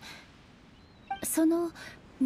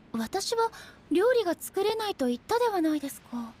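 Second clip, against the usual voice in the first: higher-pitched, quicker objection; mild protest; 6 semitones higher than usual. Acted. Reminding a friend she cannot cook, mildly protesting. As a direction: object in a raised pitch, still polite.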